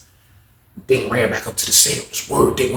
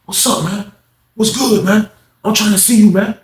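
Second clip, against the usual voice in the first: higher, taunting challenge; mock menacing Dick; 8 semitones higher than usual. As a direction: step up and taunt him. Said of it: "He was like".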